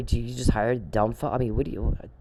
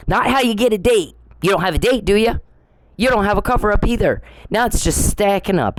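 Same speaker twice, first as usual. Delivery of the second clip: sarcastic taunt; 6 semitones higher than usual. Real, not acted.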